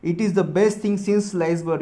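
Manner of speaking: example read out slowly; measured dictation. Shown as a demonstration. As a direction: read it for the class to write down. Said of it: "जैसे example देखो".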